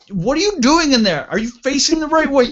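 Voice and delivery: high-pitched disbelief, acting out a buddy; imitated incredulous holler. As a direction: holler it high, as if through a toilet door. Buddy calls into the porta john.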